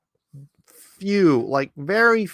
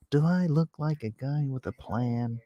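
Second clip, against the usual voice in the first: quoting Biden; 8 dB quieter than usual. Retold or imitated. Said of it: "Does he?".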